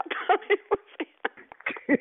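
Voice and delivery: high breathless laugh through words; can't stop laughing mid-sentence. Real, not acted. Recalls mistaking stranger for brother.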